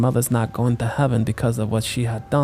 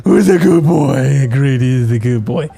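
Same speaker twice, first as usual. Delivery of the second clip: put-on baby talk to dog; 5 dB louder than usual. Talking to the dog.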